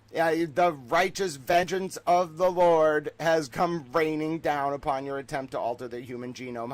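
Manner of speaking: mock-righteous proclamation; mock preaching. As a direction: thunder it like a hammed-up preacher. Declaims God's vengeance in mock sermon.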